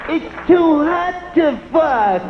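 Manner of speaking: put-on high, slow, whiny girlfriend voice. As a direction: drag it out like a fed-up woman. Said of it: "she'll be like".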